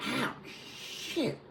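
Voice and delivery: squeezed, breathy cry of pain, soft; pained yelp. Retold or imitated. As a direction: re-enact getting hit, voice strained and hushed. Retold pain of being shot.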